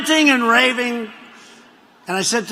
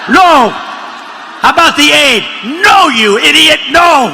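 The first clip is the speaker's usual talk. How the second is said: loud, snapping denial, half laughing; a hammed-up shout of No, you idiot; mock exasperated shout; 5 semitones higher, 7 dB louder than usual. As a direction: bark back No like a cornered senator. Acted Feinstein-Cornyn quarrel.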